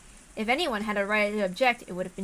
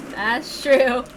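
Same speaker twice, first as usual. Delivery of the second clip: reader chuckling at dark humor; real laughter; 3 semitones higher than usual. Real, not acted.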